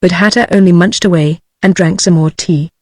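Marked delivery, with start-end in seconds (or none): none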